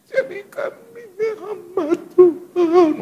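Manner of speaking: high, slow, crying plea; tearful prayer. Retold or imitated. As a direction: pray it weeping, stretching each word. Friend praying in tears.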